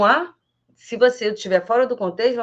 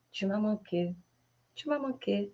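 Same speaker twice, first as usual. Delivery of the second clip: much softer tu m'as manqué; tender whisper-soft; 11 dB quieter than usual. Shown as a demonstration. Teacher softly greeting family at home. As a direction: breathe it softly.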